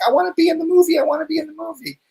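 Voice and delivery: put-on high, childlike whine; imitating his son. Dad imitates his toddler son begging to appear. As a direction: mimic a little kid begging. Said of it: "He like".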